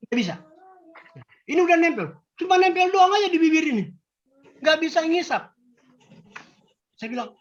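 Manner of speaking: ordinary talk, nothing marked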